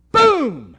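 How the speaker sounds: shouted high, sudden punch on Boom; loud boom. Real, not acted. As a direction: hit Boom like a thunderclap. Preacher's dramatic punch word.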